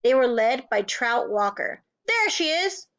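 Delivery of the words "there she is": high, sharp shout. A narrator's reading. Trout shouting to the mob, accusing. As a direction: Trout yelling at the teacher. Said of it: "Trout shouted".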